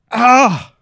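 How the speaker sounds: pained cry. Acted. Cuts his own hand.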